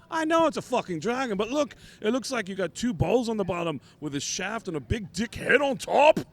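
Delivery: mock exasperation, pitch cranked way up. Voicing exasperated [name].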